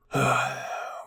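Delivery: audible tired sigh; long-suffering sigh; weary sigh. Acted. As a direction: sigh in exasperation. Levi sighs at Hanji.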